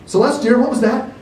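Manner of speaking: high, loud call from the camper. A narrator's reading. Mother calling her daughter from the camper. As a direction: call across the campsite. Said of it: "called the woman".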